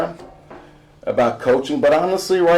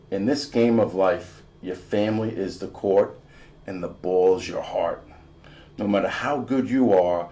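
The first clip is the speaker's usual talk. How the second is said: lower, soft, measured creed; solemn rule reading; 4 semitones lower, 4 dB quieter than usual. A narrator's reading. Narrator reciting basketball rule, lower and soft. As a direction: read it like a sacred rule.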